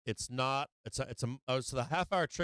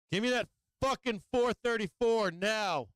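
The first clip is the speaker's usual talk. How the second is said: high, loud, demanding yell; shouting at the chart; 10 semitones higher, 4 dB louder than usual. Real, not acted. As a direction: bark the order at the screen. Yelling at the trade.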